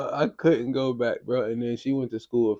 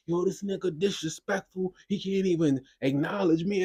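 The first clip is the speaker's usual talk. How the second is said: higher mom voice scolding her daughter; a hint of an angry mom, pitched up; 8 semitones higher than usual.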